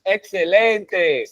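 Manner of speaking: drawn-out slow "Excelente"; slow praise. Real, not acted. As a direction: stretch "Excelente" out warmly. Praising student win.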